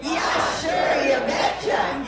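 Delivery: slow, loud Minnesota shout; leading the crowd to shout it; loud Minnesotan call. Shown as a demonstration. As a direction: belt it out in Minnesotan. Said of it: "say it loud".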